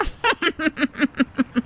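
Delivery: a soft, smug villain chuckle; gloating goon laugh. Acted. Jaggu gloats to Kamlesh about the money, smug chuckle. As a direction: laugh like a bully pleased with his loot.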